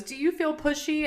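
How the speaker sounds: lightly voiced midwife asking, pitch up high; imitating the midwife's high questioning. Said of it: "midwife goes".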